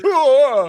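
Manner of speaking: loud, high mock death roar; death cry. Father in the stepped-on house, hammed-up death cry. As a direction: bellow a hammed-up dying yell. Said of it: "this death cry".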